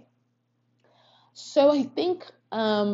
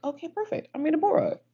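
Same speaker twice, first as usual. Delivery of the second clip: high pleased self-quote; 12 semitones higher, faster than usual. Retold or imitated. Self quoted on finding the book.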